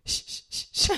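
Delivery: breathy hurried shushing whisper; imitated friend hissing shh down the phone. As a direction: hush them down fast and low. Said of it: "she goes".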